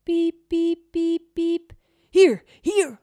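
high, loud beeping then calling; beeping detector. A narrator's reading. Neighbour imitating the detector, high loud beeps. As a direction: beep like a metal detector and call out. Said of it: "roept de buurman".

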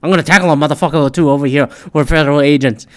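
fast, loud joking shout; joking yell. Retold or imitated. Joking to the marching protesters, a fast loud mock yell. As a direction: yell it out as a joke. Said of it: "jokingly yelled out loud".

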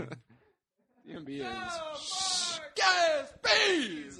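high, stretched-out shouted yell. Pretend. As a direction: bellow it out, stretched long. Shouting out DMB joke.

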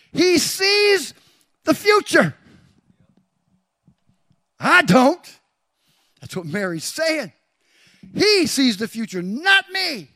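high, very loud preacher's shout; full-force preaching. Real, not acted. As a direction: proclaim it at the top of your voice, driving onward.

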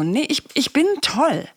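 high-pitched, stubborn self-persuasion; acted insistence, pitched well up; insistent affirmation. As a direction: protest against your own doubt. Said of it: "dann sagst du nochmal so".

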